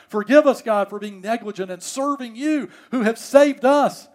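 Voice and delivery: loud, high, urgent plea to God; fervent preaching prayer. Real, not acted. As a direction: plead for forgiveness with full preaching force. Fervent prayer preached at full force.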